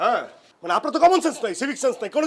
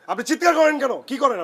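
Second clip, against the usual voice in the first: higher, sharper accusing question; angry raised voice; 6 semitones higher than usual. Real, not acted. Angry neighbour confronting the actress, voice raised. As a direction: snap back at her.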